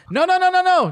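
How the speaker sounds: high, rapid-fire string of no's; acted panic, high and insistent; alarmed objection. Security guard's alarmed objection, retold.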